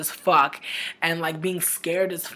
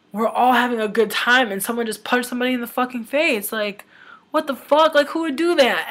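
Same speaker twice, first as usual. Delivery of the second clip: higher, building retold outburst; appalled disbelief; 5 semitones higher, 4 dB louder than usual. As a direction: relive the shocked protest, pitch climbing and louder. Retells her upset reaction to punch.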